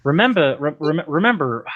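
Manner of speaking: trying out an imitated accent, word by word; Discount Hovind. Practising a caller's accent for the co-host, lightly imitated. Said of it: "trying to get the actual accent of Discount Hovind".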